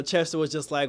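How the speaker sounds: ordinary delivery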